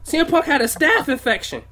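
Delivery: high, loud outrage; heated rant. Real, not acted. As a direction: hammer the point home, fired up.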